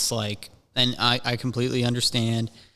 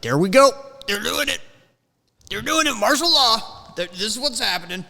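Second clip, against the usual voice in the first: high-pitched frantic excitement; keyed-up, high alarm; all hyped up; 9 semitones higher, faster than usual. Retold or imitated. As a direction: squeal it like a worked-up conspiracy nut. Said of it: "All hyped up".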